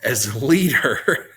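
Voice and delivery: giggling through the words, slow; cracking up while trying to restart; breaking into laughter mid-line; laughing. Real, not acted. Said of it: "I'm tired".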